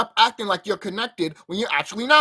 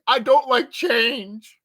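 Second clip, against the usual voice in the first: slow, higher, louder put-on voice; Stewie impression; 5 semitones higher, 5 dB louder, much slower than usual. Mocking viewers by quoting Stewie, slow put-on voice. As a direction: drag it out like a cartoon baby. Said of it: "You like Stewie".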